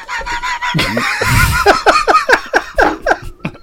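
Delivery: long high cackling laugh; big laugh. Real, not acted. Woman cracking up at her own glasses joke, real laugh.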